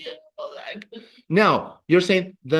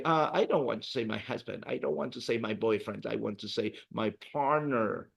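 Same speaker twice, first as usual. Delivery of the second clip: softer, quick student aside; 5 semitones lower, 9 dB quieter than usual. Retold or imitated. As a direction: mutter it like a shy learner. Teacher voices a student's wish.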